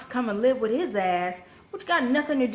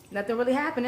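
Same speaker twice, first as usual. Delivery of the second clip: ordinary delivery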